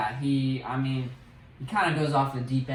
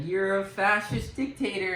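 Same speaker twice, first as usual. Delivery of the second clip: higher-pitched mock heckling; heckler; 6 semitones higher than usual. Protester heckles Homelander at the rally, higher-pitched jeer. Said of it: "a guy in a Starlight shirt".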